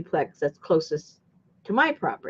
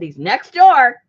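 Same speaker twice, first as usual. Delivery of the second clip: mock callout; 7 semitones higher, 11 dB louder, slower than usual. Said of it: "I said".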